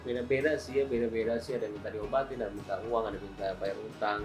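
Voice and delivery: ordinary talk, nothing marked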